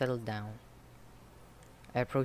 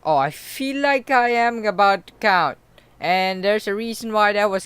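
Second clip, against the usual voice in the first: much louder, higher, slow; excited outburst; 9 semitones higher, 15 dB louder than usual. Real, not acted. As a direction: blurt it out thrilled. Gamer's own outburst.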